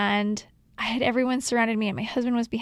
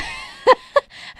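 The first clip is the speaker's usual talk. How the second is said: breathy laugh; 3 dB louder than usual. Real, not acted. Laughing while telling the story.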